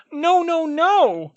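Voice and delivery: very high, loud elf squeak of protest. A narrator's reading. House elf refuses Harry, squeaking loudly in panic. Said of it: "squeaked Dobby".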